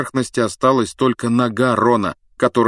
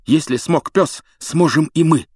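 panting; 6 semitones higher than usual. Acted. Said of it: "пропыхтел Гарри".

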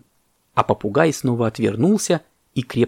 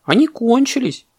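very high, puzzled monkey voice; surprised; 13 semitones higher, 4 dB louder than usual. A narrator's reading. Monkey tells parrot bananas are gone, surprised. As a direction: point it out in squeaky wonder. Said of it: "удивилась мартышка".

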